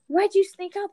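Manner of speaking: high, loud retold yelp of alarm; mock startled mom. Imitating mom startled by someone walking in, high loud alarm.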